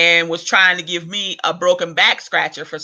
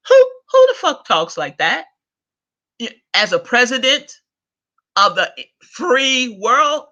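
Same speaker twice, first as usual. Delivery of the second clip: exasperated outburst; 6 semitones higher than usual. Real, not acted. Ranting at the president, a real exasperated cry. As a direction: cry out at who would talk like that.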